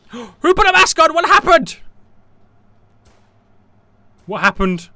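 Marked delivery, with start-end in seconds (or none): 0.1-1.8 high, loud cry of alarm; startled shout. Real, not acted. Startled by sudden event in game.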